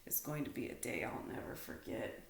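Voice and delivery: whispered, low and soft; hushed resolve. A narrator's reading. Stanley quietly promising himself, whispered low. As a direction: breathe it out to yourself. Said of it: "he said".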